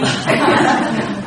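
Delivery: a shorter laugh, dropping away; real laugh. Real, not acted. Wife laughing because her husband is beside her, light laughter.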